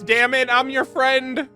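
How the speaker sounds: ordinary delivery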